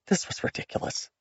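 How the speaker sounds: unvoiced, exasperated whisper; whispered exasperation. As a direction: breathe it out in frustration. Exasperated inner thought.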